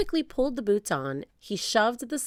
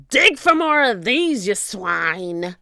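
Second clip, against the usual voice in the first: barked pirate order; 3 semitones higher, 8 dB louder, slower than usual. A narrator's reading. Captain tossing gold to his men, barking orders. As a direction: shout it as a captain's command.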